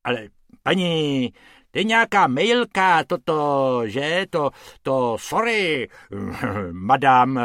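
fumbling, sheepish apology; a lightly voiced grovelling man; awkward apology. A narrator's reading. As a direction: apologize clumsily.

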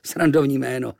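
plain reading voice

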